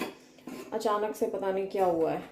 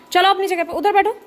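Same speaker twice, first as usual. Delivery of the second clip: much higher and louder, barked order; sharp command to pet; 9 semitones higher, 8 dB louder, faster than usual. Real, not acted. Owner ordering Jini to sit over there, loud sharp command. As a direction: call the dog off firmly.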